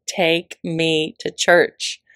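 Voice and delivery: slow, stretched out; drawn out, slow. Acted. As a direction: draw every word out slowly. Stretched repeat.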